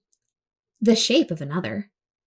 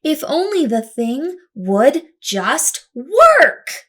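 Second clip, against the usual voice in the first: louder, slow, exasperated cry; frustrated outburst; 4 semitones higher, 6 dB louder than usual. Acted. Girl yells at her broken invention in exasperation. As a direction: plead at the machine in frustration.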